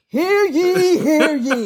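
high, ringing hear ye; town crier. Acted. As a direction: call it out like a royal herald. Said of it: "I was an actress".

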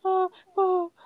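very high, breaking into giggles; real leftover giggles squeaking through; recovering from laughter. Real, not acted. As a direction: try to recover mid-laughing fit.

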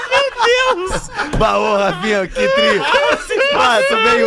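high excited squeal; excited shriek. Real, not acted. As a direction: squeal with delight.

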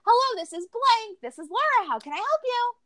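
bright, high sing-song phone greeting; high chirpy receptionist voice answering the phone; fake customer service voice. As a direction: put on the sweetest fake phone voice. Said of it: "the fakest customer service voice ever".

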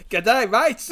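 loud, hammed-up Aussie greeting; Australian accent. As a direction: greet them in a broad mock Australian accent. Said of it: "authentic Australian accent".

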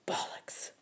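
shaky unvoiced whisper; weak defiant whisper. A narrator's reading. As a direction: barely breathe the word. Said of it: "I whispered".

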